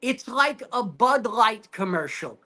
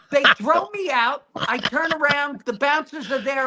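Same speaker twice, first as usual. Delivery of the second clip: ordinary delivery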